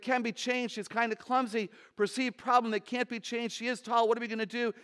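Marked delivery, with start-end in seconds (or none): none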